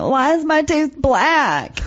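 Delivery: slow, drawn-out plaintive question; mock bewildered caller; imitated woman caller. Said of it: "did have a woman say to me".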